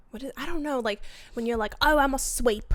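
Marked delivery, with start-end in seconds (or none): 1.8-2.7 higher Cockney chimney sweep; Cockney accent, from Mary Poppins; put-on Cockney. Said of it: "it's more like Cockney".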